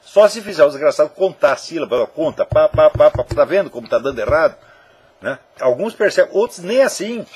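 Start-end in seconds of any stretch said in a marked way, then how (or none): 2.4-3.2 loud, punched beats; counting out syllables. Shown as a demonstration. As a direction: tap each syllable out with the voice. Demonstrates counting syllables aloud.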